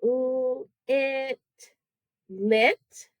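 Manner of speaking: "Ooh, it" as separate l, i, t, then joined "lit"; level phonics sounds, then the syllable; sounding out, blending. Shown as a demonstration. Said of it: "three sounds in that first".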